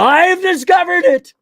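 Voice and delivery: high, slow triumphant exclamation; mock eureka cry. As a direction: shout it like a mad scientist's big discovery. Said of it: "And he grabs the bottle".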